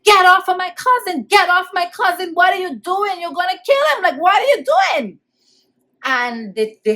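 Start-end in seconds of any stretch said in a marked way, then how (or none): 0.0-5.2 high-pitched frantic yell; retold screaming. Child at window to police beating cousin, high desperate screaming. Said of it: "I start screaming".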